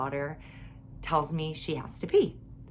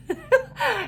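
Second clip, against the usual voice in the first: high burst of laughter. Real, not acted. Laughs at story punchline.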